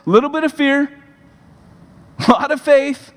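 rally the crowd, pressing each "a little bit of"; full-force preacher build; impassioned preaching. Acted.

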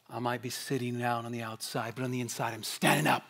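a hushed, sly inner defiance; quiet defiant child. Retold or imitated. Preacher voicing a child's defiant thought, softly and slyly. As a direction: murmur it low and stubborn, like a kid getting away with something.

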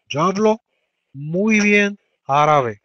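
high, very slow model sentence; slow teacher dictation. Shown as a demonstration. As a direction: stretch every word for the class. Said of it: "teacher models sentence slowly".

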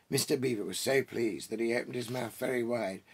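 ordinary delivery

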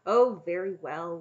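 loud, grudging concession; put-out dragon backing down; grumpy. A narrator's reading. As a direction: give in grumpily. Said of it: "Warag said grumpily".

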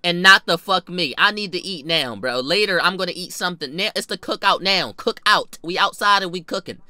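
normal talking voice

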